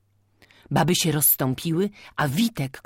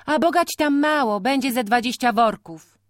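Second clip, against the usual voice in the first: put-on young man; 9 semitones higher, 5 dB louder than usual. A narrator's reading. Young man answers farmer.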